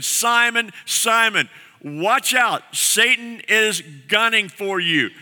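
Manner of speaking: high-pitched, urgent warning to a friend. Acted. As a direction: call out the name high, then stretch the warning slowly. Preacher voices Jesus warning Simon.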